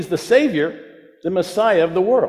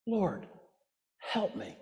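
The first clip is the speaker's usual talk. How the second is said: desperate whisper; 15 dB quieter, slower than usual. Retold or imitated. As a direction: breathe it out in desperation. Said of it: "Do you hear the desperation?".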